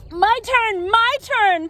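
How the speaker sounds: high, loud eager begging; pestering kid. Acted. Peter pestering the magician for his turn, eager. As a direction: whine it like an impatient child. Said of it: "pesters Peter".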